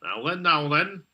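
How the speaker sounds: brief catchphrase impression; Jimmy Savile. Warning a mate what it sounds like, light catchphrase impression. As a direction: lightly mimic a presenter's catchphrase. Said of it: "don't be talking like Jimmy Savile".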